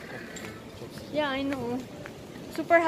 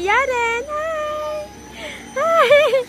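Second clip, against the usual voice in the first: much higher, loud hi; excited calling; 12 semitones higher, 7 dB louder, much slower than usual. Real, not acted. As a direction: greet her as she passes. Calling daughter on the ride.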